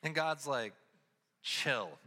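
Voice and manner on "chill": lightly voiced, lower calm command; a hint of easygoing divine voice; laid-back God. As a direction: drop the pitch, utterly unbothered. God's laid-back reply, acted.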